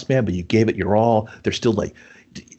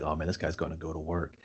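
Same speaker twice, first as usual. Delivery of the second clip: low, much softer aside; hushed awe; 6 semitones lower, 14 dB quieter than usual. Retold or imitated. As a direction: drop the voice as if awed. Retelling awed reaction to scene.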